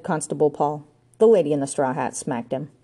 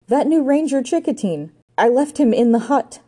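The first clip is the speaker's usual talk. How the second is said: high, louder alarm; horrified realisation; 7 semitones higher, 4 dB louder than usual. A narrator's reading. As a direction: let the dread push the voice up. Ranger horrified, going all pale.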